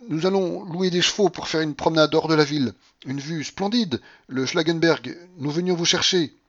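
agitated, trembling. A narrator's reading. General luring his aunt on an outing, agitated. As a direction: coax her away anxiously. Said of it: "sa voix tremblait".

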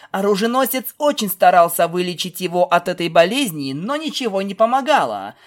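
ordinary delivery, nothing marked

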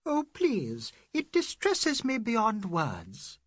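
a slow, hurt plea; mildly distressed lady. A narrator's reading. Mrs Moore pleading with her hosts, slow and hurt.

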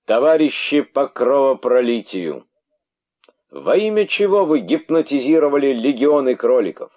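high, loud furious harangue; booming royal rebuke. Acted. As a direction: rail at the old snakes. King harangues his subjects.

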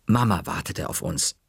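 ordinary delivery, nothing marked

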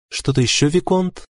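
high, composed female voice; calm wife. A narrator's reading. Wife to viscount receiving unwanted gifts, calm. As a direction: ask it coolly, pitched well up. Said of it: "спокойно ответила она".

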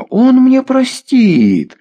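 put-on high, drawn-out drunken jeer; slow, high-pitched scornful outcry; scornful exclamation. A narrator's reading. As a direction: stretch it out in outraged mockery. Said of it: "воскликнул Ворона".

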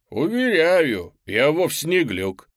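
high, loud, slow dinosaur voice; raptor voice. Acted. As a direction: reassure him as the raptor. Raptor reassuring.

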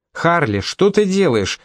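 insistent. A narrator's reading. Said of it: "не отставала Мисти".

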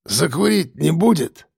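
slow, cadging boozer voice; lightly voiced drunk scrounger; scruffy drunkard. A narrator's reading. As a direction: bum a smoke like a drunk. Scruffy drunkard begging a cigarette.

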